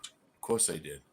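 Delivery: low, calm and deadpan; a cool unbothered answer to his friend; calm reply. Retold or imitated. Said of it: "he's all calm".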